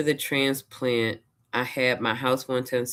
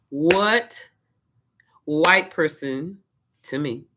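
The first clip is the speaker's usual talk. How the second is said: raised, slow indignant rant; fussing; 4 semitones higher, 4 dB louder, slower than usual. Retold or imitated. Fussing at her husband over the dressing, slow raised rant. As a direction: fuss loudly and slowly at the cook. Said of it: "I'm just fussing".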